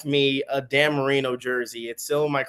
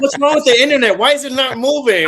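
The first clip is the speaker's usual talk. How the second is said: high, loud hammed-up complaint; mock frustration; 11 semitones higher, 10 dB louder, faster than usual. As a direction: wail in baffled outrage at a stuck screen. Mimics baffled reaction to buffering internet.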